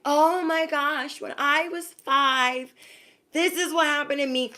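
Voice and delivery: high, slow, dragged-out mock lament; mocking whiny storyteller. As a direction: parody someone retelling old trauma. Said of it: "and they're like".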